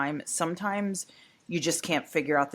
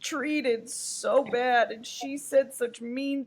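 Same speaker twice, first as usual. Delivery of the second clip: higher, very slow whimpering self-pity; mock almost-crying; 6 semitones higher, slower than usual. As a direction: drag out a fake sob. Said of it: "the almost cry".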